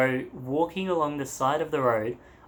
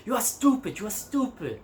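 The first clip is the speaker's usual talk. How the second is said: mock angry policeman; 7 semitones higher than usual. Officer walking around berating a hitchhiker, high mock scolding. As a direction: imitate a fuming officer in a high voice.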